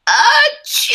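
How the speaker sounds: big sneeze. Acted. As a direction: throw out a huge sneeze. Gerald keeps sneezing.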